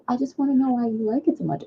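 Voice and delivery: a hint of a worried mother sitting her child down; concerned mom. Mom questions her child about a favorite show, gently concerned. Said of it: "have these conversations 'cause I think she was concerned".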